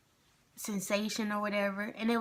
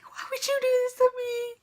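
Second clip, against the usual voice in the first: high-pitched tearful wail, imitating herself; pleading cry, much higher; crying protest; 5 dB louder than usual. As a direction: whine it out like a hurt kid betrayed by mom. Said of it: "I'm just crying".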